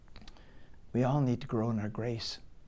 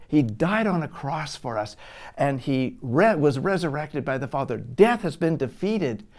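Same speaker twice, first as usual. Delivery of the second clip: louder, higher, pressing each point home; preaching at full force; 4 semitones higher, 6 dB louder than usual. Acted. Preacher driving home grace to the church, forceful. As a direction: preach it hard to the congregation.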